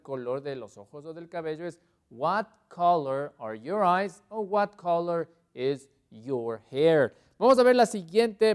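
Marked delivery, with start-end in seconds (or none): 2.1-4.2 loud, slow model question; slow emphatic recap. Shown as a demonstration. Teacher recapping the eye question, slow and emphatic. 4.4-7.1 loud, slow model question; slow emphatic recap. Shown as a demonstration. Teacher recapping the eye question, slow and emphatic.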